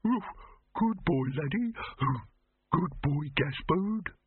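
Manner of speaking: high cooing dog-owner voice; doting voice. A narrator's reading. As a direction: coo over the dog. Voicing dog-loving human.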